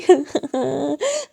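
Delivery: hammed-up sobs; acted sobbing. A narrator's reading. Girl crying at being left alone, acted sobs. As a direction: sob after the wail. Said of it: "जोरों से रोती हुई".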